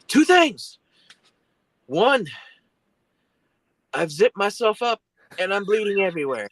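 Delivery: high, loud mock outrage; retold screaming. Injured friend listing grievances to driver, high agitated mock yell. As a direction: imitate a hurt friend yelling each point. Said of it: "he's still screaming, still screaming".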